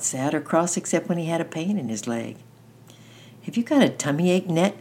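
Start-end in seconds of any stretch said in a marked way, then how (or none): 3.4-4.7 put-on raised-pitch childlike asking; sudden question. A narrator's reading. Danny asks Annette on the walk, suddenly. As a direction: pipe up with an innocent question. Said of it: "asked Danny suddenly".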